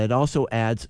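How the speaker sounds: ordinary delivery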